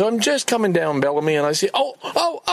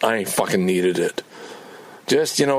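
ordinary talk

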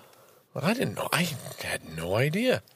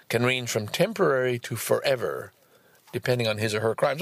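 ordinary talk, nothing marked

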